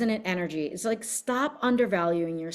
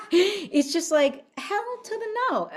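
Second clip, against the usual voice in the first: higher, sassy hell to the no; sassy refusal; 12 semitones higher than usual. Real, not acted. Telling viewers her anger returns, sassy high refusal. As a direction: put your foot down with attitude. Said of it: "I'm mad".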